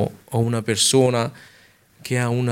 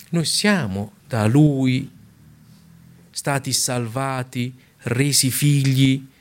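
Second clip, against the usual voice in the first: fervent preaching; 4 semitones higher, slower than usual. Real, not acted. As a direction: drive each phrase home to the flock. Preacher exhorts the congregation.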